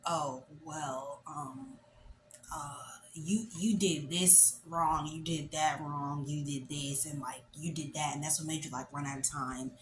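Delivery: low, soft, halting explanation; hesitant reluctant examiner. Retold or imitated. Surprised examiner telling student what went wrong, hesitant and low. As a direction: imitate someone caught off guard, trailing down quietly.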